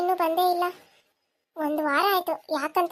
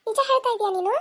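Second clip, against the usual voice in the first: shocked disbelief; 6 semitones higher than usual. Acted. Shocked at news of marriage.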